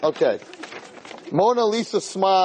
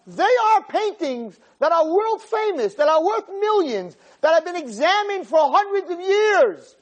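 angry lecture; 14 semitones higher than usual. Retold or imitated. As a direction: thunder the reproach at the tourists. Curator lectures loudly in English.

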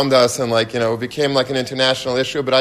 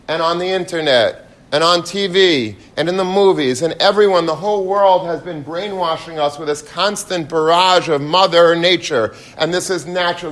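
raised, fervent sermon voice; higher, impassioned preaching; 5 semitones higher than usual. Acted. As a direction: rouse the congregation. Preaching the lesson.

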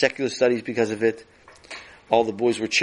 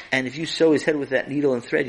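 ordinary delivery, nothing marked

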